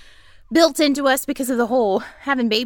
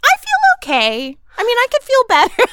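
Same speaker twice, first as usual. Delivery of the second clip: much higher, louder, cheerful shrug; breezy downplay; 12 semitones higher, 5 dB louder than usual. Retold or imitated. Patient telling doctor, high cheerful denial. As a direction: brush off the pain brightly. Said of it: "I'm like".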